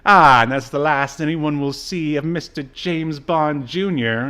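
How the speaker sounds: ordinary delivery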